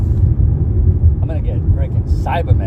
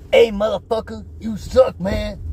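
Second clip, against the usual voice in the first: high put-on voice of a rude guy sneering; mock insult; 9 semitones higher than usual. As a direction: imitate the heckler's taunt in a higher voice. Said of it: "He was like".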